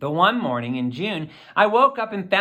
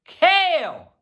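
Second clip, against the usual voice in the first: higher, louder announcing call; proud call; 11 semitones higher, 5 dB louder than usual. A narrator's reading. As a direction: proudly announce the new ingredient. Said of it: "he calls from the kitchen".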